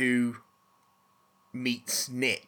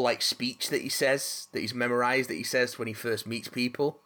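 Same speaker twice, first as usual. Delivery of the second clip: usual speaking voice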